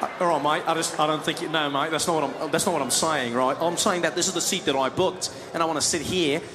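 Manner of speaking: put-on Australian accent, protesting mildly; Australian passenger. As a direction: play an Aussie who just wants his seat. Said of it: "The Australian was like".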